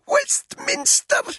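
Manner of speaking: very high, slow gasped cry. A narrator's reading. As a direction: force it out on a choking breath. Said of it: "she gasped".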